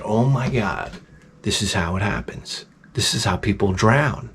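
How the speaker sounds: low, hushed dread; understated fear. Retold or imitated. Swimmer with cramps realizing he may drown, understated fear. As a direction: say it softly, as if realizing the danger. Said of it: "now I start to get scared".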